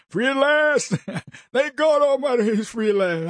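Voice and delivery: preacher cadence. Retold or imitated. Quoting MLK speech.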